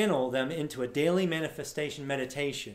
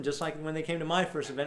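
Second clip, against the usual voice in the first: usual speaking voice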